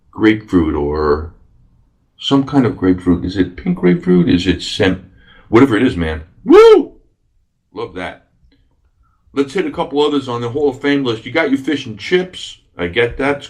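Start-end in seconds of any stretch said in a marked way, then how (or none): 6.4-7.0 high, loud "woo"; high-pitched cheer; excited whoop. Real, not acted. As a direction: let out a short delighted whoop. Excited cheer over favourite drink.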